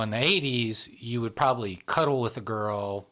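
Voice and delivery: normal talking voice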